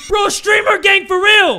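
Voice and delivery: loud, high, drawn-out hype yell; elated high-pitched holler; excited shout. Real, not acted. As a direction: shout it in triumph. Shouts over another rare find.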